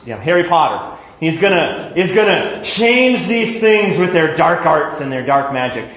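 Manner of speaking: ordinary talk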